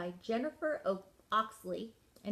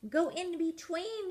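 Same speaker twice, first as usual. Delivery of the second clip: higher call of go in between; coach's shout; 9 semitones higher than usual. Acted. Coach Peg calls the penguin toward the flags. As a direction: urge the skier through the flags. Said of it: "shouted Peg".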